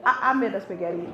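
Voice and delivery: low, stammered climb-down; sheepish admission. Acted. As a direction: give in, deflated, for the punchline. Sheepish admission undercutting the boast.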